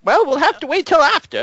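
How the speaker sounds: high, brisk dismissal; hurried professor. Acted. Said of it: "professor voice".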